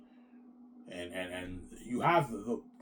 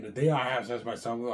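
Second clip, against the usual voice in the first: ordinary talk, nothing marked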